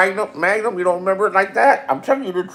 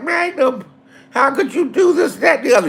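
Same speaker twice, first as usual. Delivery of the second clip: higher, pleading woman's voice; hurt woman; 8 semitones higher than usual. Retold or imitated. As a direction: whine up high like a hurt girlfriend. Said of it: "She's like".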